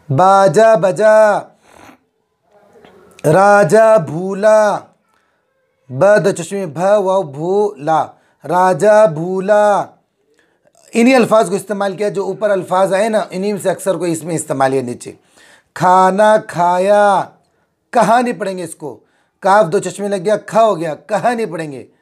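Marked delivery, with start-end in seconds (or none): 0.1-9.9 each word stretched out slowly for the class; slow, deliberate classroom read-aloud; slow reading drill. Shown as a demonstration. Teacher drills word phrases slowly. 15.8-17.2 each word stretched out slowly for the class; slow, deliberate classroom read-aloud; slow reading drill. Shown as a demonstration. Teacher drills word phrases slowly.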